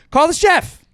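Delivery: high, loud call the chef; shouted comeback in an argument; shouted retort. Acted. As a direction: yell it at the arguer. Acting the retort.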